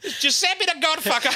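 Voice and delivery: high mock wail through laughter; hammed-up tearful punchline; laughing as you fake the wail; crying. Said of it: "Starts really fucking crying".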